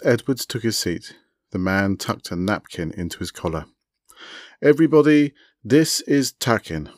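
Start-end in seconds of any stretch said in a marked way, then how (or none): 4.6-6.9 announcing. Acted. Host introducing the webcam guest to the table, announcing loudly. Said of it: "Edward announced".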